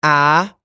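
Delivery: loud "Ah" with front Boston vowel. Shown as a demonstration. Actor contrasting three accents, Boston "Ah" without R. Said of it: "Bostonians say ah".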